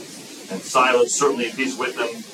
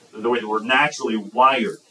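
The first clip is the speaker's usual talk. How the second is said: ordinary talk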